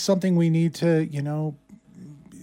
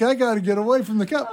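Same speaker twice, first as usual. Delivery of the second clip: imitating the fleeing suspect; 4 semitones higher, faster than usual. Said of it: "It's like".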